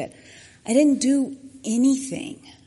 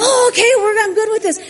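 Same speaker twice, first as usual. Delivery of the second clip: much higher and louder, laughing with relief; excited relief; 12 semitones higher, 11 dB louder than usual. Retold or imitated. Boy learns he's not in trouble, laughing excited relief. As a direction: burst out happy and relieved, like a kid off the hook.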